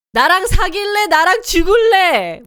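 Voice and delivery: high, loud mock dramatic threat; acting out the drama hero. Retelling the drama, a hammed-up hero's shout. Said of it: "And he goes".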